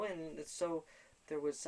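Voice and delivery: ordinary talk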